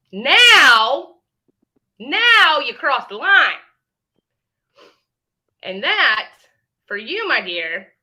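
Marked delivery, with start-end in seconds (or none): 0.1-3.5 louder, higher, slow now; angry slow warning at a rival. Real, not acted. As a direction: spell out the line she crossed, loud. Threat escalates over children.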